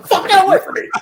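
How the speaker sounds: high panicked yell. Pretend. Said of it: "pulling your hat off, like".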